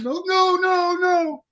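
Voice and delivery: high-pitched imitation of a woman protesting; pleading girlfriend. Girlfriend jumping in to stop a fight, high pleading.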